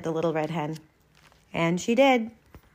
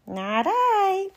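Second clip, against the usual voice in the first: very high little cat voice; meowing cat; 14 semitones higher than usual. Acted. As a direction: mew it like a kitten. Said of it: "meowed the cat".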